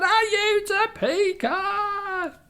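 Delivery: very high, loud, slow yell; screaming. Acted. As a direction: scream the question in panic. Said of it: "he ran around screaming".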